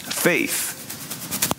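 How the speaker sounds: breathy whisper on one word. Acted. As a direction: drop to a hushed whisper.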